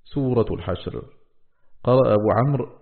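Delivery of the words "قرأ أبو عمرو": ordinary talk, nothing marked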